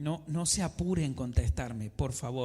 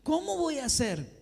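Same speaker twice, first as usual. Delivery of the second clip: higher, anxious fretting about getting up earlier; worried believer's higher-pitched fretting; desperate; 8 semitones higher than usual. Retold or imitated. Preacher voicing a believer panicking over his schedule, higher and anxious. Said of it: "de desesperación".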